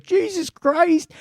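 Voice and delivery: high, drawn-out mock cry of horror; retold panicked cry. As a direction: stretch it out in a high, panicked squeal. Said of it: "he's like".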